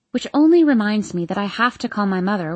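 ordinary delivery, nothing marked

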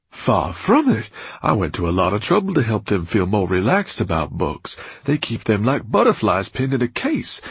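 loud, soaring, theatrical speech; Orpheus orating. A narrator's reading. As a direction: sweep them up in his passion. Orpheus's grand speech on books.

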